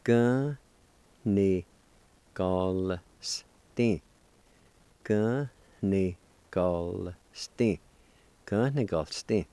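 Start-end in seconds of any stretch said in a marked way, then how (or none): 0.0-4.0 each syllable on its own with long pauses; teacher sounding out a word piece by piece; syllable-by-syllable demonstration. Shown as a demonstration. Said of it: "You can pronounce the L with the G A like this". 5.0-7.8 measured teaching pronunciation; syllable-by-syllable demonstration. Shown as a demonstration. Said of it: "like this".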